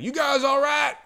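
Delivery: loud, high call across the desert; a rancher hollering to lost riders. Retold or imitated. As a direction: shout it from horseback. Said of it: "he comes and he's like".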